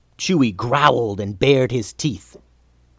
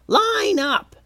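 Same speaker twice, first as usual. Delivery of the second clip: goblin yell. A narrator's reading. Steve ordering his goblins to queue, squeaky high yell. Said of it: "so he yelled".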